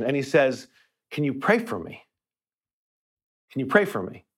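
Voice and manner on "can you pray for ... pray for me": a mild hint of a choked-up plea, softer. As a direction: imitate the mechanic asking through tears, quietly. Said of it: "he's choking with emotion".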